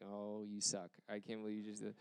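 low, very soft aside of dismay; muttered retold grumble. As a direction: grumble it under your breath, as if only thinking it. Silent grumble at unhelpful hint.

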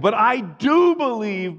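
high, drawn-out cry of conviction; exclaimed. Acted. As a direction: burst out with an earnest protest. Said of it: "boy's father exclaimed".

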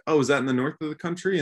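mock naive question. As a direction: play yourself eagerly getting it wrong. Acts own naive question.

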